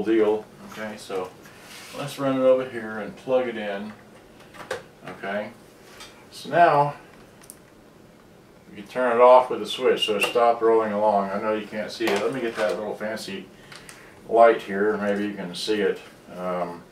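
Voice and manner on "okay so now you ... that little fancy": usual speaking voice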